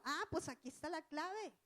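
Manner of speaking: usual speaking voice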